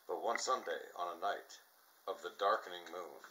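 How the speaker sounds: ordinary delivery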